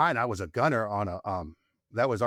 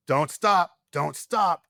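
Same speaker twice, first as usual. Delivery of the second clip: a retold urgent yell, higher and louder; gunny yelling; 6 semitones higher, 5 dB louder, much slower than usual. Gunny shouting at the driver not to stop, urgent yell. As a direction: bark the order like the gunny did. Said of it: "My gunny's yelling at me".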